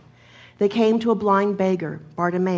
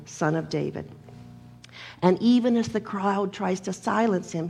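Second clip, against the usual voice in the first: ordinary delivery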